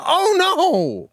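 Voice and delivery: high mock cry of dismay; hammed-up oh no; mock alarm. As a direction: echo her overblown panic. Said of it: "warrant a".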